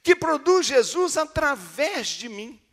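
loud, high preaching at full force; fiery preaching. Acted. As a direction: preach it out to the congregation, slow and loud.

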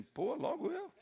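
put-on boy protesting being picked; child complaining. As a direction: complain like a startled little boy. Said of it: "O menininho fez assim".

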